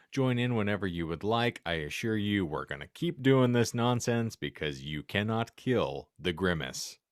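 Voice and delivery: ordinary talk, nothing marked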